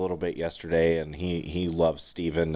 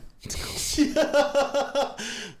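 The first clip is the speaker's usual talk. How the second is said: high burst of laughter. Real, not acted. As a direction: break into a big laugh. Laughs after banter.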